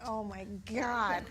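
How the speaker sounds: slow, drawn-out "Oh my God"; exasperated disbelief. Retold or imitated. Woman seeing her idea credited to a guy, slow and exasperated. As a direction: let the disbelief drag out slowly. Watching man get credit.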